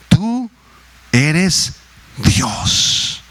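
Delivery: slow, measured psalm reading with a lift on tú; solemn scripture reading. Acted. Pastor reading Psalm ninety aloud to the church, solemn and slow. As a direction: read the verse slowly and reverently.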